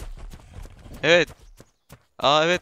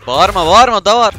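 loud outburst; 4 semitones higher, 13 dB louder than usual. Real, not acted. Game creature screams nearby.

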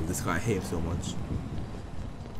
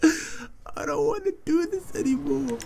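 laughing despair; 7 semitones higher, 5 dB louder than usual. Real, not acted. Enemy reappears, laughing despair.

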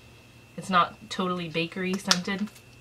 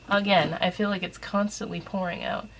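ordinary talk, nothing marked

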